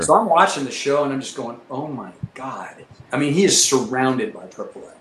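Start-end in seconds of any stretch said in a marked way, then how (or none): 1.7-2.8 slow, soft, low oh my God; hushed dread. Retold or imitated. Pilot watching his wingman surrounded by flak, slow hushed dread. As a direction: breathe it out in slow disbelief.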